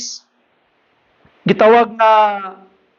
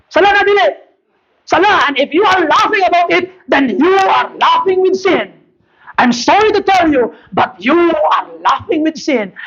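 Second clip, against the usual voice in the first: high, insistent pulpit rebuke; preach; 8 semitones higher than usual. Real, not acted. Pastor rebuking those who laugh at sin, forceful preaching.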